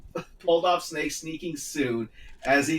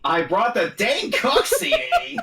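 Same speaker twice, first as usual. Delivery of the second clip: laughing; 9 semitones higher, 5 dB louder than usual. Acted. Snake line read with laugh.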